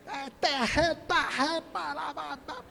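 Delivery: high, soft mock-Pernambuco patter; Pernambuco accent. Shown as a demonstration. Preacher caricaturing Pernambuco speech for the congregation, high Pernambuco accent. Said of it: "lá tem Pernambuco".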